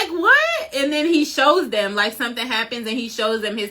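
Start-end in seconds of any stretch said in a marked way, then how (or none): 0.2-0.7 very high-pitched mock "what?"; retold disbelief. Fan acting out people hearing Luffy's claim, incredulous. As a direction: squeak it as an incredulous question. Others' stunned reply to Luffy.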